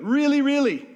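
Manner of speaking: put-on high, loud childish really, really; kid voice. Preacher mimicking his kids insisting loudly, childish high pleading voice. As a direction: play an eager kid insisting. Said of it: "Our kids do it".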